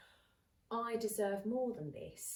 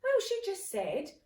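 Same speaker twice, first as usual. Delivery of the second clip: louder, higher, indignant; indignant retort; 5 semitones higher, 9 dB louder than usual. Retold or imitated. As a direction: throw it back at her, offended. Retelling a heated exchange.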